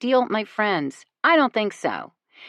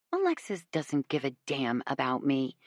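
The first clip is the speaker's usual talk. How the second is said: ordinary delivery, nothing marked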